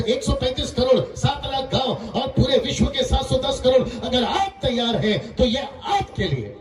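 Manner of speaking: ringing sermon delivery with held key words; full-force preaching. Real, not acted. Preacher calling on the people of town, nation and world, preaching. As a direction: preach it out in emphatic phrases.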